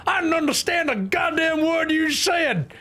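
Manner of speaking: very high, shrieky American character; hammed-up high-pitched yelling; shouting American character. Voicing an angry game character.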